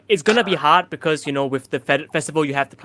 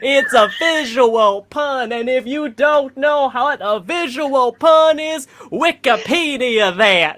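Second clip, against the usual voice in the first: high, slow parody of a comic's delivery; 6 semitones higher, slower than usual. Retold or imitated. Doing a fellow comic's jokes at him, mocking impression. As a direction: draw out the punchline in a borrowed, higher voice. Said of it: "I'm a doing L J the Funk jokes now".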